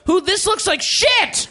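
high-pitched exasperated boss tirade; angry editor rant. Acted. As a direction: chew out the staff like a furious editor. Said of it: "He's like".

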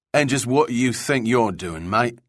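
put-on thick Cockney accent. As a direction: tough London Cockney guard. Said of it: "The guard spoke with a thick Cockney accent".

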